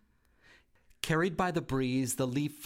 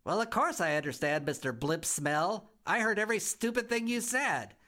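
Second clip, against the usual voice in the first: put-on a cheeky little girl laughing at the house; giggly bratty girl; 7 semitones higher than usual. A narrator's reading. Girl giggling at her new home, high and cheeky. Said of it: "she began to giggle".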